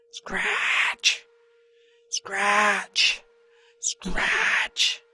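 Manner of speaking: breathy unvoiced scratching sound; whispered scratch. A narrator's reading. Narrator voicing the noise in the dark, whispered scratch. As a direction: hiss the scratch like claws on wood.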